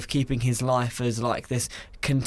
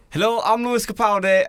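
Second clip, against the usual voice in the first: put-on high-pitched Scottish accent; playful Scottish impression; 8 semitones higher, 3 dB louder than usual. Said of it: "My Scottish is".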